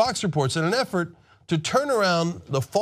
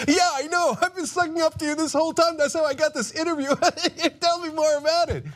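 mock fawning anchor; 12 semitones higher than usual. Host mocks anchor sucking up.

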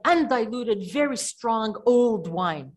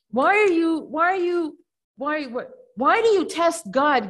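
louder, higher, demanding why they test God; indignant rebuke; 5 semitones higher, 5 dB louder than usual. Retold or imitated. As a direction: scold the elders for doubting. Yehudit rebukes the elders.

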